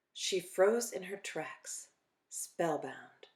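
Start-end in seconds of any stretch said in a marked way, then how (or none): none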